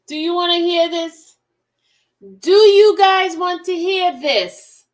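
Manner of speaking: calling out loudly, slowly. Real, not acted. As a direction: shout it to the chat, drawn out. Streamer calls out to chat.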